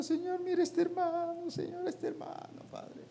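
high, pleading mock prayer. Pastor mocking crying colleagues, high pleading prayer. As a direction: whine it like a tearful pastor.

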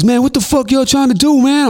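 imitating alarmed K Slade. K Slade asking what they're doing, high alarmed voice. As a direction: protest in a high squeal of alarm.

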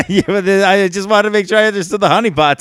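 high, giggly and flustered; laughing. Real, not acted. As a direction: laugh it off through the words. Embarrassed after crude joke.

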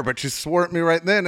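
normal talking voice

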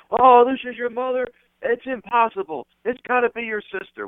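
high-pitched, gushing mock astonishment; gushing flatterer. Wrestler greeting a friend's mother, gushing high-pitched flattery.

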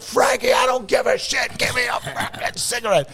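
high, desperate shouted demand; retold screaming. Boss screaming at Frankie to hand over a cigarette, high mock shout. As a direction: re-enact a meltdown, shouting up high. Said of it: "I'm screaming at him to give me a cigarette".

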